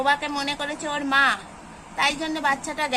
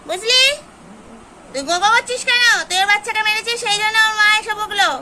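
higher and louder telling-off of a growling cat; raised, scolding voice at a cat; 5 semitones higher, 4 dB louder, faster than usual. Real, not acted. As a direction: scold the cat for hitting the kitten.

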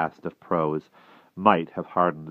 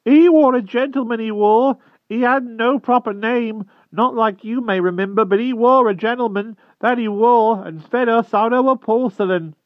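humble hedgehog voice; 8 dB louder than usual. Acted. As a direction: squeak it out humbly like a shy hedgehog. Hedgehog keeps speaking.

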